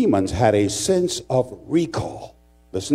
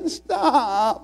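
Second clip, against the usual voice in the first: high, drawn-out mock wail; comic high-pitched plea; pleading classmate; 11 semitones higher than usual. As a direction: mimic a boy begging to be let go. Classmate begging while pushed.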